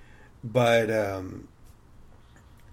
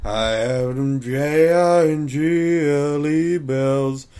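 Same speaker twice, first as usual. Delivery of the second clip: put-on drunk uncle crooning, slow and louder; slurring along at the piano, slow; booming tipsy uncle; 2 semitones higher, 5 dB louder, slower than usual. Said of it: "in drunk Uncle Frank's voice".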